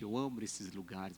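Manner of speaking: ordinary delivery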